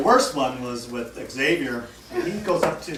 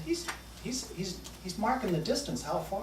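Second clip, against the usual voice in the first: much softer, hushed aside; whispering; 4 semitones higher, 12 dB quieter than usual. Retold or imitated. Warning a friend about the kid across the room, in a hushed whisper. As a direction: lean in and whisper it. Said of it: "He whispers that to me".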